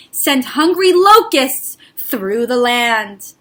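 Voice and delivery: ordinary delivery, nothing marked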